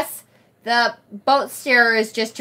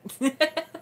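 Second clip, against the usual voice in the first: soft, higher giggle; laugh; 4 semitones higher, 7 dB quieter than usual. Real, not acted. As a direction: giggle quietly after friendly teasing. Laughs after accent banter.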